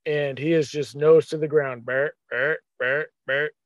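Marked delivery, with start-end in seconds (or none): 1.8-3.5 imitating a deer's grunts; deer grunt imitation. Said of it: "He must have grunted".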